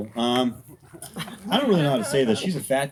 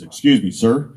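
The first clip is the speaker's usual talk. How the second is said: lightly voiced guard, suddenly loud; a hint of a stern guard; security guard's challenge; 10 dB louder than usual. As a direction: call out like a guard stopping someone. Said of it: "And the voice says".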